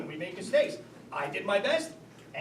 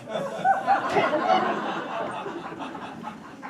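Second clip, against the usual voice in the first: high, breathy disbelieving laugh; choked laugh of disbelief; exasperated laugh. Acted. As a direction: burst into incredulous laughter. Exasperated at wife's answer.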